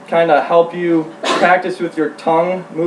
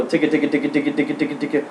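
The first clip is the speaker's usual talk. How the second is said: rapid duck chuckle; 2 semitones lower, 6 dB quieter, much faster than usual. Retold or imitated. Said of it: "they don't sit there and just go".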